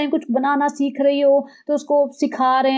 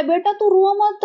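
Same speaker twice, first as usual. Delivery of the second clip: higher, slow, cooing; mock comforting husband; 6 semitones higher, slower than usual. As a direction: coo it like hushing a baby. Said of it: "Sunny उसको गले लगा रहा है". (धीमी, पुचकारती आवाज़)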